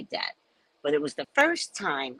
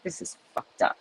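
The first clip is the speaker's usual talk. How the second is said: whispered aside; 6 dB quieter than usual. Retold or imitated. Aside to mother during story.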